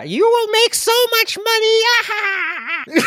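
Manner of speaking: high cackling witch curse. Pretend. Witch curses Will Smith.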